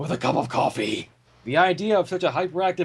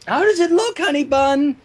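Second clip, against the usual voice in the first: lightly voiced Missus Cake; 8 semitones higher, 4 dB louder than usual. A narrator's reading. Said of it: "Missus Cake asked".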